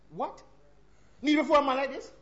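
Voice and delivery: ordinary talk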